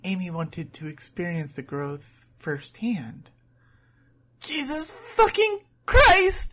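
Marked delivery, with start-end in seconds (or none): 4.4-6.5 slow, high-pitched loud scream. A narrator's reading. Overwhelmed woman pushes back on the table, screaming. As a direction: scream every word out, drawn long. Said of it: "she screamed".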